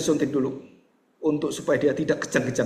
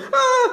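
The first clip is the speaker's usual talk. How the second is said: high mock child's cry; tangisan anak; 6 dB louder than usual. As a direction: keep wailing like a child. Acting the child still crying. (tangisan anak dibuat-buat)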